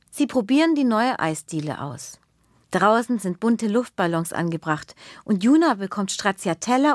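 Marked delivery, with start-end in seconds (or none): none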